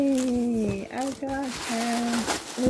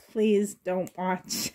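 normal talking voice

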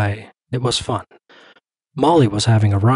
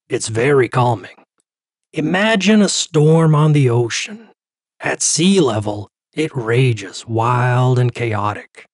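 slow, soothing therapist voice; guided relaxation. Acted. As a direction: therapist guiding a breathing exercise. Doctor's guided breathing talk.

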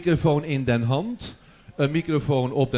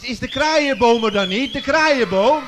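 louder and higher, searching the hall; calling out; 7 semitones higher, 8 dB louder, slower than usual. Real, not acted. Host calling for the school in the audience, excitedly. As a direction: call the school's name out eagerly.

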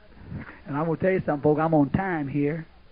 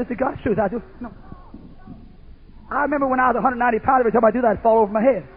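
louder, higher preaching drive; 7 semitones higher, 6 dB louder, faster than usual. Acted. Preacher telling the congregation about fasting, preaching at full force. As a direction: build it up for the congregation.

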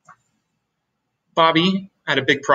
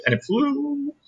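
high soft wonder; 13 semitones higher, 4 dB quieter than usual. A narrator's reading. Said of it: "repeated build".

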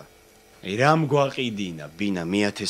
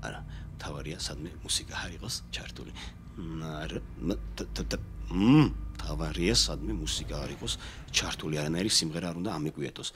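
very low, hushed self-talk; soft private rehearsal of a worry; muttering to himself; 6 semitones lower, 10 dB quieter than usual. Acted. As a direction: mutter under your breath, partly whispered. Nervously muttering to himself alone.

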